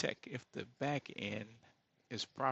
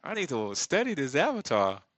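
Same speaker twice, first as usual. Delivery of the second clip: mock amazement; 5 semitones higher, 9 dB louder than usual. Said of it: "I be like".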